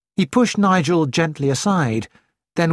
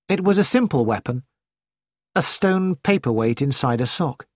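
plain reading voice

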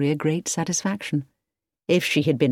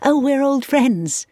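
laughing; 10 semitones higher, slower than usual. A narrator's reading. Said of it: "laughed Victoria".

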